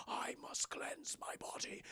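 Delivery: slow, very soft whisper; mock-ominous breathy hush; possessed whisper. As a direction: whisper it slowly as if entranced. Possessed Justin acted out.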